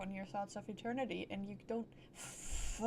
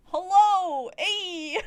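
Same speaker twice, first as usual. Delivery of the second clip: loud, high hello shout; playful shouted hey; shouted greeting; 7 semitones higher, 14 dB louder, much slower than usual. Pretend. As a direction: yell a hello at the screen. Streamer shouts greetings at the screen.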